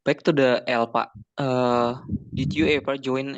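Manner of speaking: ordinary delivery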